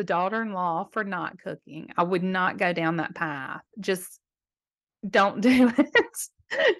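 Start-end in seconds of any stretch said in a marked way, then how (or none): 3.8-6.8 slow, laughing mid-phrase. Real, not acted. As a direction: chuckle right through the warning. Laughing advice to grandmother.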